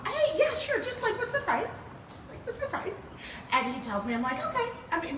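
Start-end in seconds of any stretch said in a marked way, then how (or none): 0.1-2.9 high, casual, over-friendly phone voice; airy, much higher pitched polite query; trying-to-sound friendly. Retold or imitated. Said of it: "I'm trying to sound friendly, not desperate". 4.4-5.2 high, softer, agreeable reply; pitched way up, politely unbothered; friendly phone voice. Retold or imitated. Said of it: "I'm trying to sound friendly".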